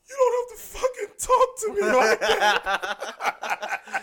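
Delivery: very high, loud, drawn-out wail; hammed-up tearful squeal; imitating crying outburst. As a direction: bawl it out like a huge man in tears. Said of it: "he just breaks out crying".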